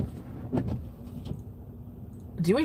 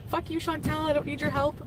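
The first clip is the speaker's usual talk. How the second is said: imitating forced speech; 7 semitones higher, 4 dB quieter than usual. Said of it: "make her say, like".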